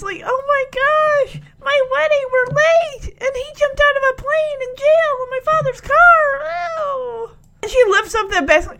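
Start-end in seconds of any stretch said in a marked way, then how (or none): 0.2-7.3 very high mock-tearful wail; on the verge of tears. Bride lamenting her late wedding, on the verge of tears. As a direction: whine it out, hammed-up near sobbing. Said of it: "she was on the verge of tears".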